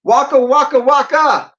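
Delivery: hammed-up comic catchphrase; Fozzie catchphrase. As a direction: shout it like a corny comic bear. Joke catchphrase on air.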